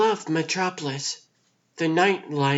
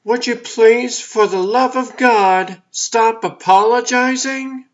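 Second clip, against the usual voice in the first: exasperated; 4 semitones higher, 7 dB louder than usual. Acted. Exasperated plea to apologizing sister.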